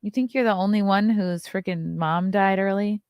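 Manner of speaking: usual speaking voice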